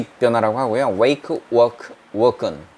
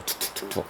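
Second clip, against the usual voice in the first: footstep sounds in a soft whisper; 4 semitones lower, 14 dB quieter, faster than usual. Shown as a demonstration. Teacher whispers footsteps to students. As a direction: tap out the steps under your breath. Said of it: "발걸음이 툭툭툭툭 하는 소리".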